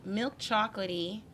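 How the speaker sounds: usual speaking voice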